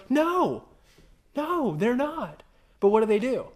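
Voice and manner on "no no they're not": emphatic no. Real, not acted. Preacher answering own question.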